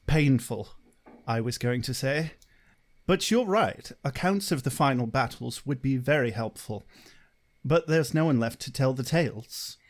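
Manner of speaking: Giles. Shown as a demonstration. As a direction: do a restrained Englishman, with pauses between phrases. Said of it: "Do the Giles for me".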